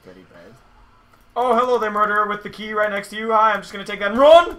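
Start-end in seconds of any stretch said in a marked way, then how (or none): 1.3-4.6 loud, mocking greeting then dash; cheeky taunt. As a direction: tease the killer sweetly. Taunting murderer, grabbing key.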